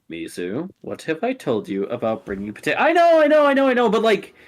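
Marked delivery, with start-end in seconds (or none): 2.8-4.3 loud, high rushed excuses; defensive whine. Acted. Mizu cutting off the host's scolding, loud and high. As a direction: blurt it out high, defending yourself.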